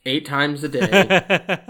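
slow, stretched out through laughter; laughing. Real, not acted. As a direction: crack up while adding the punchline. Cracks up at own joke.

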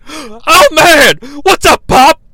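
imitated loud, hyped-up hollering; excited yelling. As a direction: start high, stretch the last phrase slowly. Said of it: "[name] embraces this appearance by yelling".

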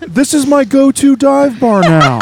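high, slow, drawn-out laid-back surfer voice; Owen Wilson. Pretend. Host reads a dive bar review as a high, slow Owen Wilson. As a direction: stretch every word like a stoner dude. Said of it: "Owen Wilson".

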